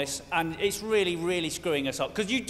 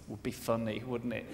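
sly murmured aside; 11 semitones lower, 11 dB quieter than usual. Retold or imitated. Sly thought every Briton had.

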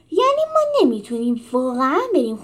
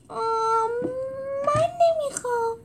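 timid small voice; 14 semitones higher, slower than usual. Acted. Timid animal refuses.

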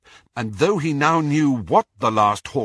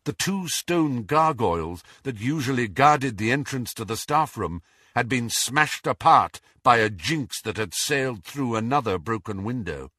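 usual speaking voice

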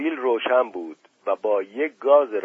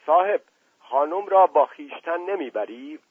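lightly voiced Indian sardar, pitched up; 7 semitones higher than usual. A narrator's reading. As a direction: ask politely as the Indian gentleman, voice raised in pitch. Indian sardar addresses uncle in story. (سردار هندی، با صدای کمی نمایشی)

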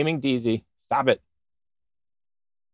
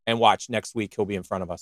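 ordinary talk

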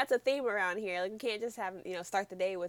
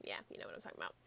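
soft, low whisper under the breath; hushed aside, much lower and softer; 14 dB quieter, much faster than usual. Real, not acted. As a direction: murmur it to yourself as an afterthought. Trailing aside to cohosts.

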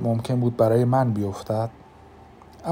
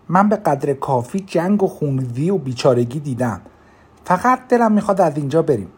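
angry; 4 semitones higher, 4 dB louder than usual. Acted. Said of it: "با عصبانیت گفتم".

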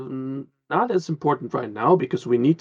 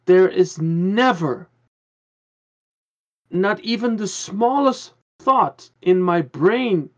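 ordinary talk, nothing marked

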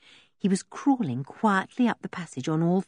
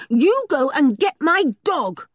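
high, louder indignant command; ordering; 9 semitones higher, 5 dB louder than usual. Acted. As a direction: bark the order at the captors. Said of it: "ordered George".

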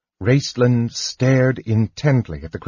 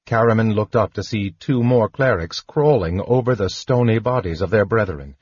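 ordinary delivery

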